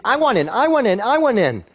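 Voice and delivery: high, keen pleading of a hooked investor; eager investor. Pretend. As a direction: sound like an investor who can't wait.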